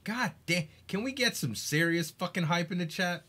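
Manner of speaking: ordinary talk, nothing marked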